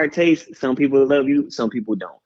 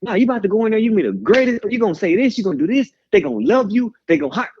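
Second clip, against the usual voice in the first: hype-man pep talk; 7 semitones higher, faster than usual. Retold or imitated. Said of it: "That's me".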